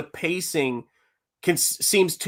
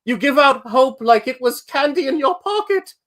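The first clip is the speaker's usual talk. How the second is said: high, hammed-up movie line; Postman movie quote; 10 semitones higher, 4 dB louder than usual. Quoting The Postman to his wife, hammed up high. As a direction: ham up the cheesy line to tease her. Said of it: "I go".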